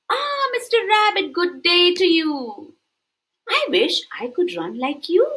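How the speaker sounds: high, polite greeting; courtly hello then a wish; tortoise voice. Acted. Tortoise greeting Mister Rabbit, high and polite.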